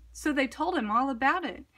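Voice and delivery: ordinary delivery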